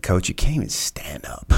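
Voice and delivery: whispered flat put-down; dry whispered rebuke. Retold or imitated. Master Chief telling injured coach he can't go, whispered. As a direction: deflate him under your breath. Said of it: "Master Chief's like".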